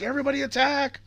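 high-pitched rallying cry at normal volume; retold call to attack, voice jumping up high; mock battle cry. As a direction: imitate a character ordering a charge.

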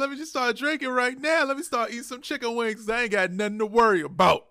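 high, carefree excited celebration; retold overconfidence. Fan retelling his first-half mood, giddily confident. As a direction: crow like the win is already in the bag.

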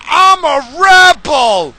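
high, loud, slow sneering "I'm a rebel!"; mock rebel yell. Vlogger mocks wannabe punkers with a high hammed-up yell. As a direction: ham up a poser's proud cry.

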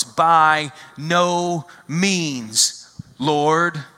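very slow, stretched-out protest; a reluctant no, stretched long; drawn-out refusal. Acted. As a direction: drag out every word of the refusal. Peter answering God in vision.

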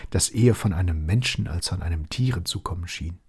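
ordinary delivery

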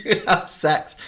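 put-on high baffled child question through a laugh; high, half-laughing child voice; puzzled kid. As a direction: ask it like a confused young kid. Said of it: "I'd be like".

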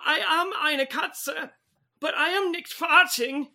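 a hint of a hammed-up German cat voice; German accent. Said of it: "Don't yell in German".

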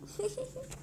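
soft, high titter; quick high-pitched giggle. Real, not acted. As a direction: titter softly. Laughs about turning eighteen.

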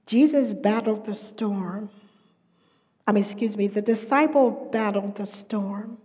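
ordinary delivery

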